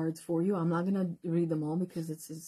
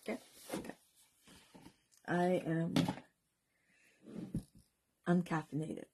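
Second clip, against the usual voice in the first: ordinary talk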